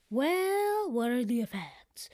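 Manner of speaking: high, drawn-out "Well" before the question; mildly hammed-up querying tone. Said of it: "I questioned".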